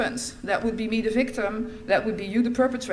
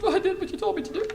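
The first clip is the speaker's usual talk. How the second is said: mock innocent child; 10 semitones higher, faster than usual. Daughter defending to father.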